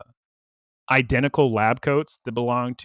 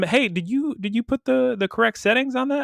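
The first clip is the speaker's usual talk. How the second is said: mildly worried, higher-pitched asking; concerned; 8 semitones higher than usual. Retold or imitated. Rotom asks Ash about the washer settings, concerned. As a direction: imitate a nervous check-in, pitched up. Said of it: "Rotom is concerned".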